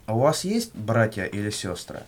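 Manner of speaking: normal talking voice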